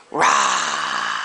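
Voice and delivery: very low growling rah; mock scary bear roar. Shown as a demonstration. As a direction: growl like a big mean bear for the baby. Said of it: "I want a mean, scary bear".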